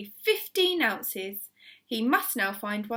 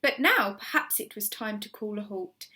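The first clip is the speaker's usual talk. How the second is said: plain reading voice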